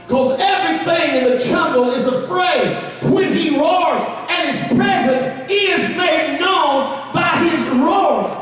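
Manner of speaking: rising preacher's shout, slow on the roar; preaching at full force. Acted. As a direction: build it like a revival preacher.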